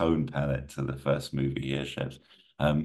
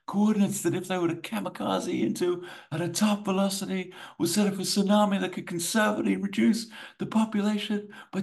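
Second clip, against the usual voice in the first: high, raised, urgent plea; urgent official. Acted. Official briefing Poe under chopper blades, high urgent voice. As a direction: push it up high, as if over helicopter blades.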